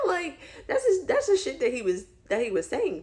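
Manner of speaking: high, loud, still laughing; laughing through words. Real, not acted. Retelling his excuse to viewers, laughing while talking.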